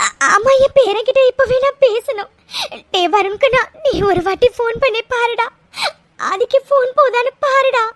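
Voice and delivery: weeping grandmother begging for a call; tearful pleading. Acted. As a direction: plead through sobs, catching on the first word. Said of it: "பாட்டி அம்மாவும் அழுதுட்டே இருக்காங்கடா".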